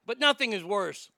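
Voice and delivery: normal talking voice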